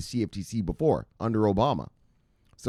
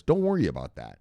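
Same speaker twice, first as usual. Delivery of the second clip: ordinary talk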